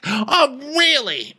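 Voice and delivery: high, challenging retort; mock indignant. [name] answering Diana's admission, high mock indignation. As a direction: throw it back high and affronted.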